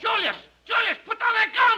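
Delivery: high, loud panicked plea; frightened wife pleading with her armed husband; terrified pleading wife. Acted. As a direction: beg him to drop the gun. Acted wife pleading at gunpoint.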